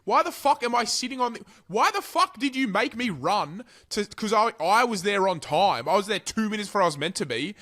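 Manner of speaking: ranting about boarding too early; exasperated rant. Real, not acted. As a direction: vent at the airline in disbelief. Ranting at airline over early boarding.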